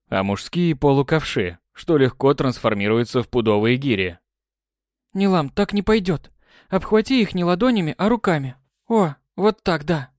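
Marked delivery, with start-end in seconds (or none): 5.1-10.1 high, bright coaching call; eager exclamation. Acted. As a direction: cheer her on excitedly. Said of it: "воскликнула Елена".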